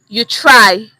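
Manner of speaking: "you try" as a flat, simple well done; plain praise. Shown as a demonstration. Teacher shows the plain "you try". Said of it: "Same words, different signal".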